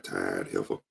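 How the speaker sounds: low, soft catty mutter; snide aside. Retold or imitated. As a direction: drop it under your breath behind her back. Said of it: "Soon they turn around".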